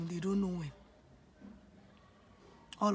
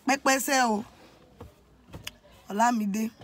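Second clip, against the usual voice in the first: louder firm call of her name; dad's loud call; 5 dB louder than usual. Retold or imitated. Father calling daughter by name, raised voice. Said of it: "my dad said".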